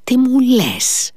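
slow, high-pitched astonishment; stunned, stretched question leaping up in pitch; taken aback. A narrator's reading. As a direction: draw it out in amazement. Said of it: "ο Ρακίτιν τα χάσε".

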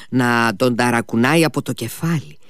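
plain reading voice

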